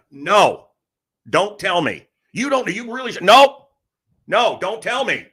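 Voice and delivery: high, punchy retold "no"; mock emphatic refusal. Husband refusing his wife's news, acted emphatic refusal. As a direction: cut the news off, hammed-up.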